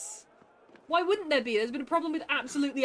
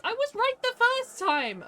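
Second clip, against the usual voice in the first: high, loud gleeful crow; triumphant shout; 12 semitones higher, 5 dB louder than usual. Real, not acted. Streamer crowing to chat that her first guess was right. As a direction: celebrate being right.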